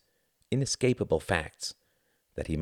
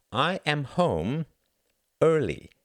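slow, higher, deliberate; son's voice; 9 semitones higher, 3 dB louder, slower than usual. A narrator's reading. Eldest son to his mother, slow and pointed. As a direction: lay each word down pointedly.

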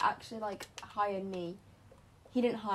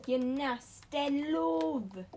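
silly put-on voice; 4 semitones higher, 5 dB louder, much slower than usual.

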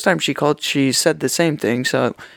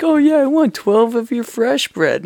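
high, slow put-on customer voice; lightly hammed-up high-voiced customer; mock customer; 9 semitones higher than usual. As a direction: play the cheeky customer asking for far too much. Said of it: "he's like".

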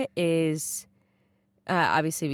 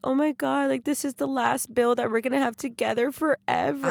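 higher, imitating mom tearing up over the last bill; mock teary mom; 9 semitones higher than usual. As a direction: tease her by playing her weepy voice, pitched up. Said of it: "the lady that cried".

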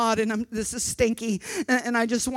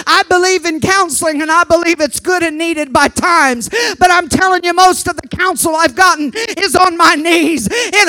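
loud, higher shouting preacher; old-time revival preaching; preaching at full force; 7 semitones higher, 11 dB louder than usual. Acted. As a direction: drive it home to the congregation.

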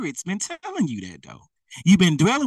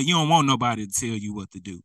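ordinary delivery

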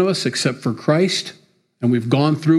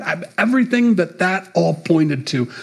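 normal talking voice

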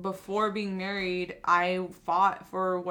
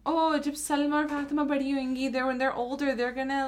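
higher-pitched imitation of matchmaking relatives; gossiping relatives; 6 semitones higher than usual. Relatives talk of young girls' weddings, higher-pitched imitation. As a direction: mimic relatives chattering about future weddings.

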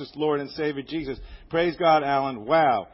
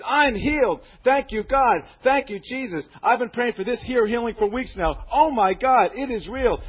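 higher and louder, ecstatic praise; joyful scream; 7 semitones higher, 4 dB louder than usual. Retold or imitated. Healed inmate praising God in the pill call line, joyful scream. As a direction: cry out your thanks like a man just healed. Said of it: "He screamed".

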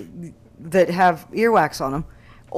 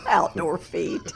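laughing; 3 dB quieter, slower than usual. Real, not acted. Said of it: "That's hilarious".

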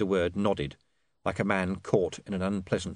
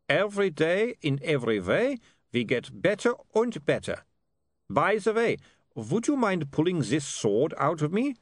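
high-pitched comic Germanic vampire; chirpy vampire; 8 semitones higher than usual. Acted. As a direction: grumble good-humouredly in a vampire accent.

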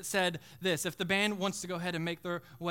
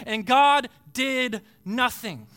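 impassioned preaching; 4 semitones higher, 7 dB louder than usual. Real, not acted. Preacher climaxes on total abandonment.

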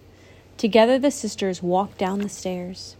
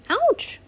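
sharp, high yelp; cry of pain. Acted. Lacey tripping and scraping her knee, high yelp. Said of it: "Lacey cried".

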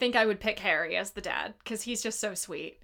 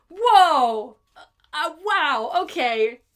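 stunned whoa; 4 semitones higher, 7 dB louder, much slower than usual. Retold or imitated. Reacting to Donna's outburst.

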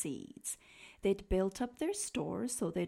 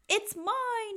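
mild shout; 9 semitones higher than usual. Acted. Cyril claims the cone from Bruce, mild shout. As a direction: call it out high. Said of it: "shouted Cyril".

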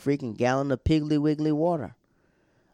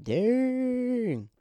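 very high, long drawn-out "dang"; stunned reaction; 13 semitones higher than usual. Acted. Podcaster reacting to Peyton's put-down, stunned. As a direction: stretch it out in a high, mock-shocked wail.

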